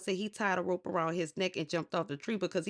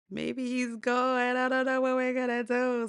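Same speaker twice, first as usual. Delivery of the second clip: worried wife; 6 semitones higher, 4 dB louder than usual. Retold or imitated. One wife to the other, fearing Shango left, high and fretful.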